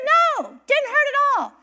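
put-on high, eager little boy saying no; a mom imitating her young son's happy reply. Mom voices her son's answer.

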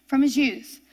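ordinary talk

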